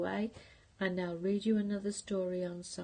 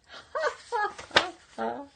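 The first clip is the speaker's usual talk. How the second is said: mock laughing; 10 semitones higher than usual. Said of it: "And Fluffy cried".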